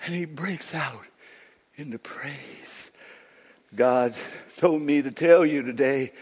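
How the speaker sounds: soft, slowed sermon with long pauses; hushed preaching. Real, not acted. Preacher telling listeners God wants to be found, hushed and slow. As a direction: let it sink to a tender hush.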